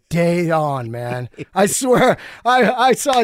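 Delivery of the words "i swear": ordinary talk, nothing marked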